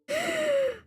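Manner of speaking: short high laugh; quick bright laugh. Real, not acted. Laughs after the whisper.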